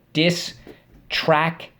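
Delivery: Distraction stretched out very slowly; slow drill call. Shown as a demonstration. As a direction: drag out Distraction for the class. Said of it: "Let's go".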